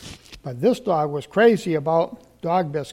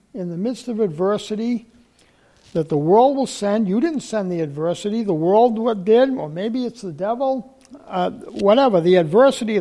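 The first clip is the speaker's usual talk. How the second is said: preaching at full force; 2 semitones higher, 5 dB louder than usual. Acted.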